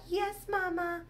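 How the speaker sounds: put-on high, soft little baby voice; small, high-pitched toddler voice. Shown as a demonstration. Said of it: "I don't really do voices that much. If I do, I just do a".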